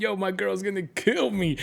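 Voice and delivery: mock panicked guy. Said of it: "He's like".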